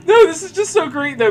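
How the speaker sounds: high, loud gleeful praise; bursting with glee at the fanfic; excited delight. Real, not acted. Friend gushing over awful fanfic, excited.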